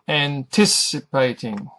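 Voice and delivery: Anticipating slowly, with TIS stressed. Shown as a demonstration. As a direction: draw out Anticipating, leaning on TIS. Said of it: "The tis section is emphasized".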